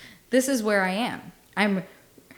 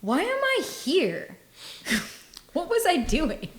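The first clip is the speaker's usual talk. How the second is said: much higher, lost and puzzled; confused; 7 semitones higher than usual. Retold or imitated. Describing brain fog at work to the cohost, bewildered. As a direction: wonder aloud where you are, bewildered. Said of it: "you look around and you go".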